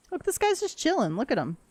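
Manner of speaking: high, cutesy pet voice; cooing at an animal. Real, not acted. Streamer points out a chilling animal, high cutesy coo. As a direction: fawn over the lazy critter.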